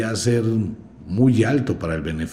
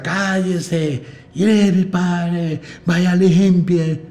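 high, loud operatic shrieking of orders; mock screaming mother; 10 semitones higher, 4 dB louder, much slower than usual. Speaker mocking a mother yelling at her kids, mock operatic shriek. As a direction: caricature a mother barking orders like an opera singer. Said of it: "parece que estuviese haciendo un curso de ópera".

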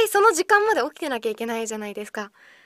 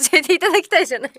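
quick laughing correction, faster; laughing self-correction; 2 semitones higher, 4 dB louder, much faster than usual. Real, not acted. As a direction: laugh while correcting yourself.

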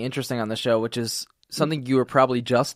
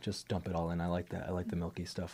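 softer, lower aside whisper; 4 semitones lower, 11 dB quieter than usual. Real, not acted. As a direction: murmur it under the breath. Asking for coleslaw mid-meditation.

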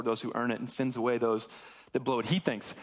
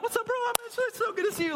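happy greeting; 4 dB louder, faster than usual. Acted. Brother's expected happy greeting.

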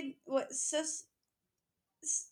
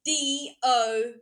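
spelling it out; 13 dB louder, slower than usual. Pretend. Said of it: "spell dog".

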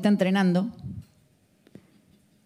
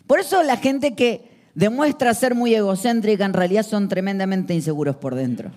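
ordinary talk, nothing marked

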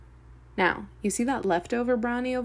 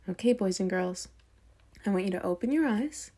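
ordinary delivery

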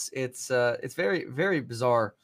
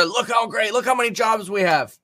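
a hint of Biden, lightly voiced and a bit louder; bad Biden impression; 8 semitones higher, 4 dB louder than usual. Mocking the president's jobs boast, a light put-on voice. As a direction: sketch Biden bragging, barely changing the voice. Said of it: "I can't do a Biden accent".

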